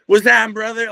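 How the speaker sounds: high, loud mock deadhead greeting; imitating a laid-back deadhead pal; hippie bro. Artists in the dead scene greeting him, high bro voice. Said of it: "it's like".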